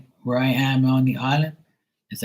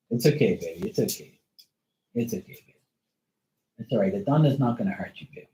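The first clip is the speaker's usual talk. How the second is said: soft reassuring coo to a scared dog; calm the frightened dog gently, quieter; soothing pet; 3 dB quieter, faster than usual. Real, not acted.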